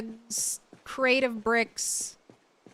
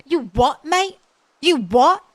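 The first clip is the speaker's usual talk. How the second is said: mock British You what, mate, high and loud; British comeback; 5 semitones higher, 5 dB louder than usual. Streamer imitating a British guy answering a shout. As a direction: fire back like a cheeky London lad, British. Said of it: "shouting at someone like".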